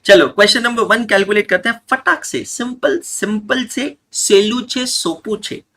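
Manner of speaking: high, bright push to start; upbeat teacher push. Real, not acted. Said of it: "teacher hyping a simple question".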